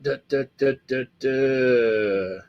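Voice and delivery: sing-song da-da-da; absent-minded string of das; idle vocal filler. Real, not acted. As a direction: fill time while scanning, stretching the last da. Vocal filler while scrolling chat.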